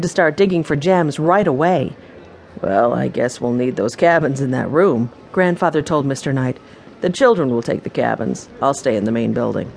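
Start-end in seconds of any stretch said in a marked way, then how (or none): 2.6-5.1 lightly voiced lower grandfather; a hint of an older man, pitched down. Grandfather taking the rooms, lightly voiced grandfather. Grandfather's figure speaks to owner. 7.0-9.7 lightly voiced lower grandfather; a hint of an older man, pitched down. Grandfather taking the rooms, lightly voiced grandfather. Grandfather's figure speaks to owner.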